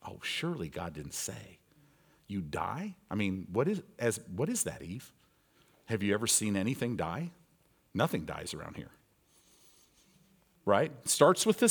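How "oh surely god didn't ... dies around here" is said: tempting serpent. Acted. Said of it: "the way it was with Eve".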